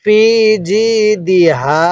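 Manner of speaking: ফি and জি drawn out, then দি হা; measured ফি জি দি হা with pauses between; slow model reading. Shown as a demonstration. Teacher showing how to read, stretched ফি and জি. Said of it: "কিভাবে পড়ব".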